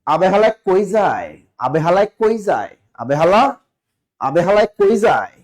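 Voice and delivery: a mild imitation of an Old Dhaka local; Old Dhaka dialect. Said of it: "তারা কইবে". (পুরান ঢাকার আঞ্চলিক টানে হালকা নকল)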